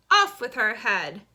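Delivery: lightly hammed-up royal yell; mock yell. A narrator's reading. Queen in Alice in Wonderland ordering an execution, mock yelling. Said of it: "always yelling".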